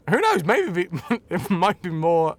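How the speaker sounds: ordinary talk, nothing marked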